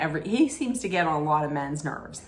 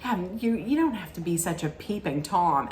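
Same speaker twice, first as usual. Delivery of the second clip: teasing Luann; 5 semitones higher than usual. Retold or imitated.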